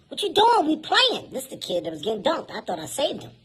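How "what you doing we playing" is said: put-on high, whiny kid voice; kid's voice. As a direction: protest like an annoyed little kid. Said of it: "The kid goes".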